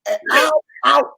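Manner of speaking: bolting in fright from the corpse; mock panic. Boy imagining the corpse popping up, mock panicked shout. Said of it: "I'd be like".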